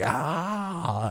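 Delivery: retold scream held long at contained volume; imitated scream. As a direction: mimic a long running yell without full force. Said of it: "you start s-, screaming".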